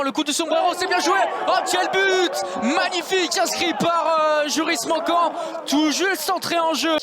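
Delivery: loud, high excited goal call; goal shout. Real, not acted.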